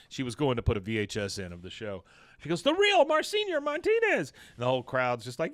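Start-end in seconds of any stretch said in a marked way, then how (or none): 2.6-4.3 very high, thrilled intro; high gushing announcement; excited announcement. Retold or imitated. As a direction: gush out the star's name. Peggy announcing star.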